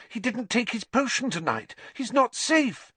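high, frightened warning; gasping alarm. A narrator's reading. Hermione warns that Lupin missed his potion, gasping in alarm. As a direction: build to a panicked high cry. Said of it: "Hermione gasped".

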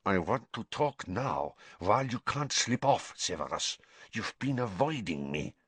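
a lightly voiced foreign headmaster, insistent; insistent, lips barely moving. A narrator's reading. Karkaroff pressing Snape so nobody hears, lips barely moving. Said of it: "barely opening his lips".

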